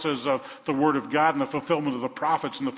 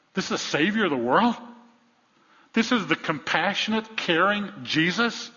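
incredulous questions; 6 semitones higher, 4 dB louder than usual. Acted. Mock disbelief questions.

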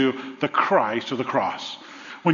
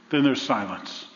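ordinary talk

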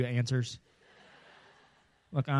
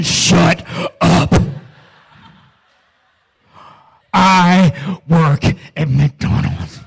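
irritable snap; 4 semitones higher, 12 dB louder, much slower than usual. Retold or imitated. Snapping at friend after test.

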